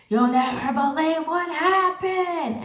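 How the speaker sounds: high, slow, drawn-out gossip voice; you'll never believe it, drawn out high; gossipy excitement. Retold or imitated. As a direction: spill the news, eyes wide. Calling sisters with big news.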